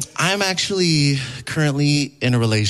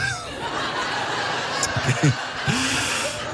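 high breathless laugh; airy laugh; 12 semitones higher, 6 dB quieter than usual. Real, not acted. Comedian laughing at his own punchline, breathless. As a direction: crack up silently.

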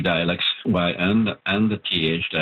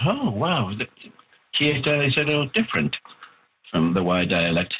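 high, quick little "oh wow" of discovery; mock surprise; 5 semitones higher than usual. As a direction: voice a reader's sudden realisation, pitched up. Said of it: "will realize".

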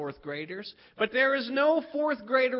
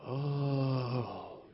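low, soft drawn-out "oh"; group gasp; 10 semitones lower, 7 dB quieter than usual. Retold or imitated. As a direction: imitate kids awed by what they heard. Kids' hushed reaction, acted.

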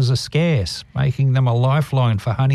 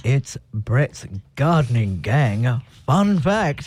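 sexy voice; slower than usual. Pretend. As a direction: purr it out slowly like a crooner. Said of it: "You've got to do the sexy voice".

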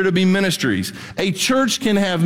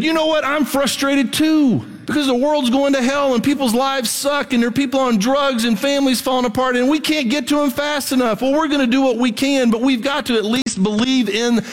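higher, fired-up preacher voice; full-force preaching; 6 semitones higher than usual. Real, not acted. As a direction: preach it with rising heat. Preacher ramps up, frustrated.

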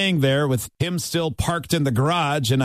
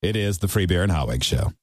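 usual speaking voice

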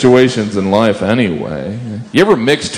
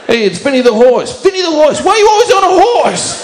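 put-on high, shouted Italian American mobster accent; a bad, loud Italian American mobster; 3 dB louder than usual. Greeting the undercover horse at the mafia, shouting in a bad Italian American accent. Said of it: "worst Italian American impression".

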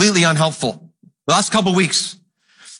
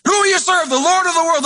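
much higher, pressing question as Joshua; Joshua's challenge; 11 semitones higher than usual. Retold or imitated. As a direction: force them to pick a side. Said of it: "That's what's happening in this text".

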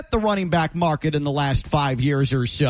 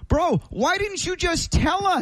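high, drawn-out incredulous plea; mock exasperation at being told so late; exasperated disbelief; 11 semitones higher than usual. Exasperated reaction to late name correction.

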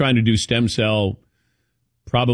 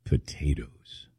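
low, slow mock doctor voice; imitating the doctor; 8 semitones lower, 10 dB quieter, slower than usual. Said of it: "And he talked like this".

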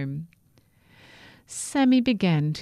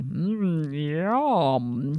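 hungry albatross; 2 semitones lower than usual. A narrator's reading. Albatross eyeing Sammy.

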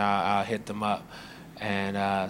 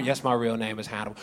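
weary deadpan; 6 semitones lower, 6 dB quieter than usual. Retold or imitated. Own answer flat low.

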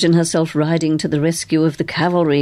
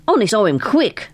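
ordinary delivery, nothing marked

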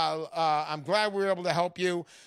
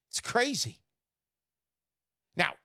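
ordinary talk, nothing marked

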